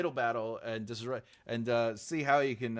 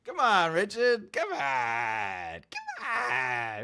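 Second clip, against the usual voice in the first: playful whining plea; 2 semitones higher, 4 dB louder, much slower than usual. Pretend. Streamer begging Richard to come on, whiny mock wail. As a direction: whine it out like a begging kid.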